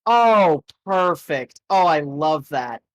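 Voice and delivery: ordinary talk, nothing marked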